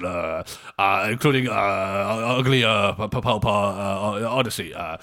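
loud, blustering, stammering politician with endless uhs; hammed-up bumbling prime minister statement; Boris Johnson impression. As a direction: bumble through a mock press statement, very loud. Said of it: "we need to up the accent game".

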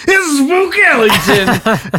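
loud, high, drawn-out scare cry, laughing; jump-scare shout. Retold or imitated. Kid jumps from the closet to scare his family, loud, slow shout. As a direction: leap out and yell it slowly. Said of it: "right then you're like".

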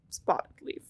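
hushed, voiceless whisper; dramatic whisper. Acted. Telling whom Fireheart smells in the dream, whispered. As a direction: whisper it like a mystical reveal.

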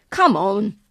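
put-on loud, curt command from a boy; unfriendly order. A narrator's reading. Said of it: "the unfriendly boy ordered".

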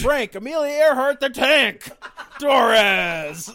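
loud, high wrestling intro; ring announcer. Pretend. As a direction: belt out the name.

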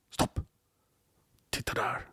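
hushed acted warning; urgent whisper. Hunter warns his guide on the mountain, urgent whisper. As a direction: whisper it so the prey won't hear.

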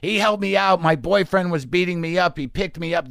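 lightly voiced woman pleading innocence; mock pleading hooker. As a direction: play the put-upon victim for the cops. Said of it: "doing this song and dance for the cops".

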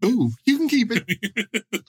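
high, slow, teasing character voice; slow goofy high voice. Acted. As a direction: drag it out, squeaky and smug. Voicing orgy guest.

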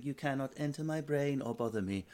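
mock Buddha serenity. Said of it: "a Buddha move".

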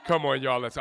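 loud re-enacted rallying bark; punchy, loud show of being mad; acted mad. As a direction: call it out like a fed-up coach. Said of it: "actually mad when I say".

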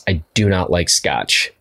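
ordinary talk

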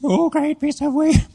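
high, slow mock-girl chant; girl's reluctant repeat. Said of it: "And she said".

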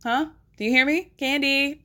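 playful check for a response; mock calling out. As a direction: call to someone who is ignoring you. Calling to unresponsive Candy.

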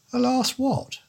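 high, plummy English don baffled by "Alas"; puzzled don. Acted. The don puzzled by the detective's brand, high and plummy. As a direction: pitch "Alas what?" way up, puzzled.

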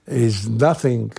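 ordinary delivery

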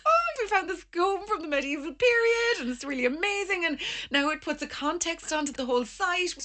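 high, breakless excited gush. Retold or imitated. Diggers tell the Australian about the comb, excited imitated gushing. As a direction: act out gushing over a discovery. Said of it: "we got so excited".

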